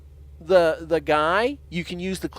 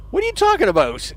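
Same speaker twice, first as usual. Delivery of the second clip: indignant protest; 9 semitones higher, 3 dB louder than usual. Acted. Ray protests, voiced higher.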